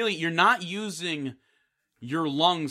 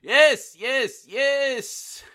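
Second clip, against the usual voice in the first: higher put-on voice, a hint of a foreign accent; hammed-up coworker; 9 semitones higher, 4 dB louder, much slower than usual. Coworker greets Petul asking about the report. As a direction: sing it up as the friendly boss.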